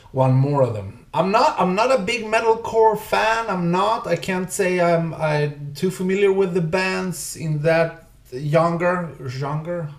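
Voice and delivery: ordinary delivery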